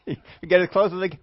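ordinary talk

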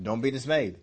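ordinary talk

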